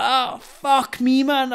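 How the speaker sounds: high, drawn-out mock dismay; slow, high-pitched swearing in retold shock; retold shocked relief. Storyteller recognised by the knifeman, slow high retold shock.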